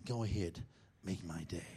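low, soft gravel-free growl of a movie tough guy; lightly done tough-guy line; Clint Eastwood. Retold or imitated. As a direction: say it like Dirty Harry daring him, low and quiet. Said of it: "Clint Eastwood".

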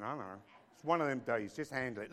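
usual speaking voice

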